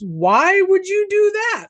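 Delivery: high, drawn-out, incredulous retold question; mock appalled. Joe reacts to talk of suicide, mock appalled. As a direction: imitate someone aghast, stretching it out high. Retelling Joe's shocked reaction.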